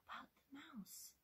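hushed, unvoiced-breath storytelling whisper. Real, not acted. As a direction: whisper it like a secret.

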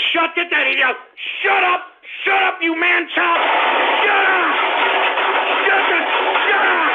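furious high yell of shut up at the caller; angry shouting. Acted. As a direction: bark it at the top of your lungs. Host yelling at prank caller.

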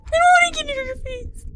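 high, squeamish squeal. Real, not acted. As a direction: recoil in squeamish disgust. Reacting to animatronic face.

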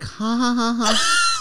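fake laugh. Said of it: "Are they like".

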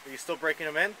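ordinary delivery

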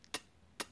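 isolated T released as pure breath; whispered T. Shown as a demonstration. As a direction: whisper just the letter T, twice.

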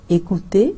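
écouter, "ay-koo-TAY", rising at the end; French model word. Shown as a demonstration. Teacher repeats écouter for the class, rising French tune. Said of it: "मैं फिर से repeat करूँगी".

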